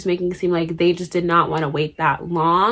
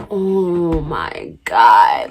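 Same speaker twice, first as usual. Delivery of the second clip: very slow, lowered dread; drawn-out dismay; 4 semitones lower, much slower than usual. Retold or imitated. Teen asked for mom's number, slow dismay. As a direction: stretch every word out, sinking lower.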